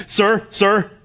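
high, loud, scolding questioner; hammed-up lifeguard demanding order; officious lifeguard. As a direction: call over the screaming like a fussy official. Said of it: "and he says".

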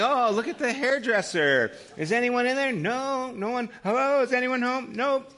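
high, sing-song window-shopping game; talking to a toddler. Retold or imitated. Grandfather calling into shop windows for his grandson, playful child-directed voice. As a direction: play it up high for a little child.

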